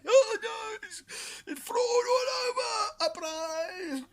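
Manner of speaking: high, loud, crying shout of a spoiled brat; over-the-top screeching Don parody; Trump tantrum impression. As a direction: wail it like a toddler in a tantrum. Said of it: "screaming and shouting like a spoiled little brat".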